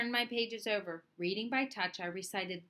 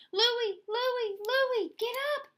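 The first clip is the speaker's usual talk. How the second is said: put-on much higher, louder boy's call; friend calling to wake; 14 semitones higher, 6 dB louder than usual. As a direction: shake him awake with your voice. Gabriel wakes Louis.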